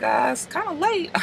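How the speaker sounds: high, soft phone voice; imitated reluctant answer. Millie gets a late call, soft and high. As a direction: speak small and high, put out.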